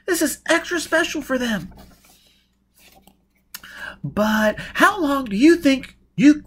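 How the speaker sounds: loud, high-pitched urgent tirade; heated, insistent near-shout; exasperated. Acted. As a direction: drive the danger home, at the end of patience. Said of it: "exclaimed in exasperation".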